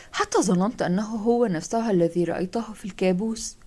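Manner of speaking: ordinary delivery, nothing marked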